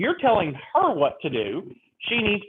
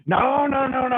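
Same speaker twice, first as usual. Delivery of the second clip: higher, louder client waving it off; stubborn client; 5 semitones higher, 3 dB louder than usual. Retold or imitated. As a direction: keep saying no, higher and dug in. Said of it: "Mister Garrett, let's go in and submit".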